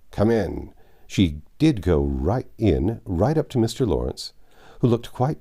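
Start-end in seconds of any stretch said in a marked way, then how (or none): 0.1-0.7 lightly gruff call through a door. A narrator's reading. Old gentleman answering a knock at his study, slightly gruff. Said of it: "when a gruff voice called out".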